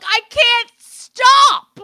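high, loud, drawn-out mock wail; helpless outburst. Retelling her reply about the accent, helpless wail. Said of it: "I'm like".

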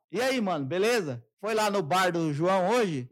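loud, laid-back bro talk to his dad; casual buddy talk. Retold or imitated. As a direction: greet your father like a drinking pal. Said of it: "eu falo assim com meu pai".

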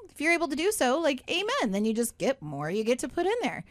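usual speaking voice

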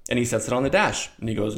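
ordinary talk, nothing marked

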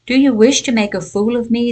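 fast, spluttering fury at being mocked; angry sputter. A narrator's reading. King to the thief over the plum pit, fast angry sputter. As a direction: spit the words out, offended and fast. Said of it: "the king sputtered angrily".

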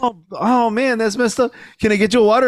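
high, fussing over someone; flustered apology. Retold or imitated. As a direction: overeager and guilty. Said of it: "like he felt".

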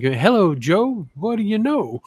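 lightly voiced jive slang, rising; a hint of a Harlem hepcat; 1940s jive talker. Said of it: "grandmother used to talk like that".

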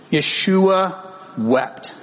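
very slow, weighted reading; solemn slow. Acted. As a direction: let the two words sink in. Solemn verse read.

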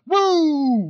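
high, loud Ric Flair wrestler whoop; wrestler's trademark whoop; Ric Flair woo. Retold or imitated. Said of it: "Ric Flair, woo guy".

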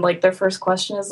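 ordinary talk

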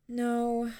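plain reading voice

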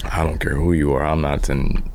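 ordinary talk, nothing marked

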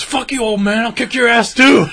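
loud, high hammed-up threat; mock yell. Retelling a punk cursing at the old store owner, loud mock yell. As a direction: bark it like a punk kid. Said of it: "And he goes, he goes".

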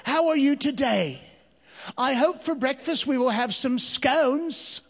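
mock-posh British greeting; British accent. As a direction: play at being very British at teatime. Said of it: "with a British accent".

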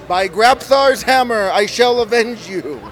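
high, slow, grand oath; dramatic movie line. Acted. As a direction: declaim it like a ham hero. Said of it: "everybody does Galaxy Quest".

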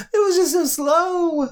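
high, squeaky laughing-crying; words squeezed out through tears of laughter. Real, not acted. Laughing fit over bird joke.

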